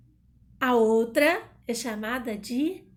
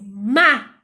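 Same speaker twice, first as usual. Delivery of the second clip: mágico with MÁ much louder than the rest; stress on MÁ; 11 dB louder than usual. Shown as a demonstration. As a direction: hit MÁ hard, let gico drop. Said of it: "Não é o co, não é o gi". (mágico com o MÁ bem forte)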